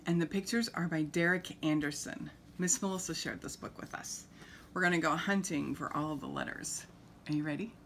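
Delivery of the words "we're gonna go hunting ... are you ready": usual speaking voice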